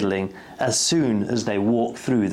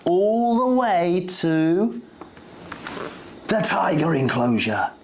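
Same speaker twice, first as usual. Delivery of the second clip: suspense; 4 semitones higher than usual. A narrator's reading. Suspense building toward tiger.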